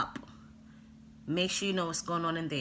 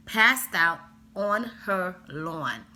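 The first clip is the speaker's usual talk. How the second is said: slow, emphatic words in a slight Jamaican accent; 4 dB louder, slower than usual. Real, not acted. Telling a friend about the kid on the lawn, slow with a Jamaican lilt. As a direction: her Jamaican accent slipping out on the word lawn. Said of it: "God, my Jamaican accent".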